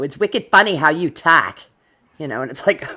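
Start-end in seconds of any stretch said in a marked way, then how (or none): 0.0-1.6 loud mock New England kid; New England accent. New England boys mocking a newcomer's speech, New England accent. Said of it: "I moved to New England".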